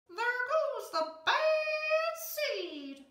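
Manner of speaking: high slow sneering voice; mocking mumble. A narrator's reading. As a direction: drag it out like a snide whisper behind his back. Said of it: "they mumble".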